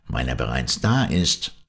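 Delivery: understated, matter-of-fact answer; simple reply. A narrator's reading. Doctor explains his theory to Shandon, simply and plainly. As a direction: state it simply, without emphasis. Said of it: "erwiderte einfach der Doktor".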